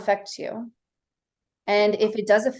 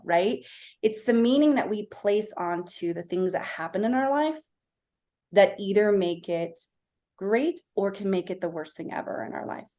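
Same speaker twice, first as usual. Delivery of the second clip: ordinary talk, nothing marked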